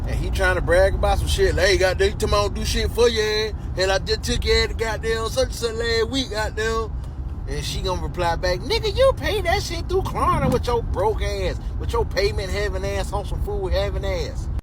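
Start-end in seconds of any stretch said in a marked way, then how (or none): 1.7-6.9 higher, fast, defensive bragging; bragging boyfriend. Acted. Acted boyfriend bragging to girlfriend. 8.6-14.5 much higher, sassy mocking comeback; mocking girlfriend. Acted girlfriend's mocking reply.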